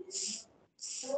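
a long unvoiced s, teeth together, air pushed between them; hissed s sound. Shown as a demonstration. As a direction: hiss the s through clenched teeth. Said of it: "mình đẩy hơi qua cái kẽ răng là s". (âm s xì qua kẽ răng)

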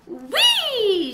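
high loud squeal. A narrator's reading. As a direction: squeal again. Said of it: "she squealed again".

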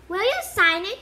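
high, loud eager request. A narrator's reading. Colin begging Harry for an autograph, eager and loud. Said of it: "said Colin eagerly".

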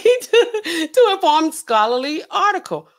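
laughing while reading. Real, not acted. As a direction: read it out through a real laugh. Laughs while reading chat comment.

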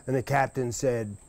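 plain reading voice

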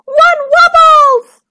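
maid's high, slow, loud shriek; screamed. A narrator's reading. Maid passing on news of the wobbly tooth, screaming. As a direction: scream the news in alarm, stretching it. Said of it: "screamed the maid".

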